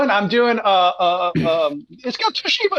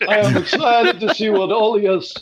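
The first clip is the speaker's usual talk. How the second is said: put-on Israeli accent, enthusing; Israeli scientist; 3 semitones higher than usual. Scientist urging Cameron to meet Carpathia, mock Israeli accent.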